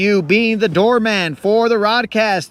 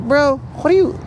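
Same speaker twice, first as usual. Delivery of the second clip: higher, teasing bro jab; mock scolding; 8 semitones higher than usual. Ghost scolds crying friend, teasing higher jab. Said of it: "And I'll be like".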